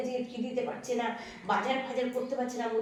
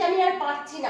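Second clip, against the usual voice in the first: despairing; 6 semitones higher, 5 dB louder than usual. Acted. Mother breaks down.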